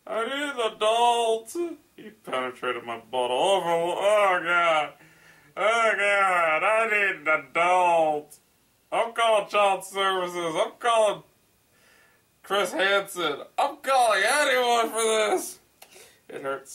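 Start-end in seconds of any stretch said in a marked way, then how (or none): 0.1-1.5 high, loud cry for help; hammed-up plea for rescue; mock panic. 3.6-15.6 high, loud hammed-up distress; mock panic.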